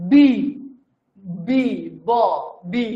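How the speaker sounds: slow drawn-out letter name বি, said on its own; teacher sounding out a letter. Shown as a demonstration. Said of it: "এইটার উচ্চারণ কি ভাওয়েলের মতো হয় কিনা".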